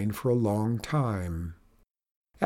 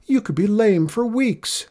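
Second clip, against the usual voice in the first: much higher, fretting voice; alarmed warning; 9 semitones higher, 4 dB louder than usual. A narrator's reading. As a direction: pile worry onto a friend. Abel warns Nagel of worse.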